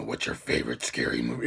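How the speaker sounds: very low, soft gravelly phone voice; gravelly caller. Acted. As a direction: drop deep into a menacing gravelly rasp. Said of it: "like a gravelly voice".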